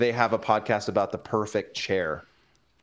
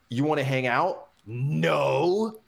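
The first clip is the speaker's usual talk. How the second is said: mocking question, then a drawn-out, higher no; misread as hostile; 4 semitones higher, slower than usual. Friend misreads the casual text, hostile and mocking. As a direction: exaggerate it into a scornful refusal. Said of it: "the other guy reads it and he goes".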